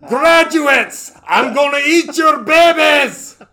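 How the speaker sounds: loud, high Scottish bellow; drawn-out comic scream with a Scottish accent; Fat Bastard. Acted. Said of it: "not to scream that".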